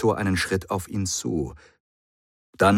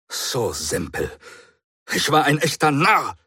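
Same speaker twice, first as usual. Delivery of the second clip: a self-scornful snort of a line; angry at himself, spit it out; snorting in self-disgust; 8 semitones higher, 3 dB louder than usual. Acted. The Doctor curses his own foolishness, snorting. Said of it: "schnaubte der Doktor".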